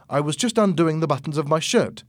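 plain reading voice

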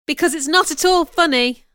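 high, loud objection; offended, emphatic retort; indignant. A narrator's reading. Disbelieving a marriage proposal.

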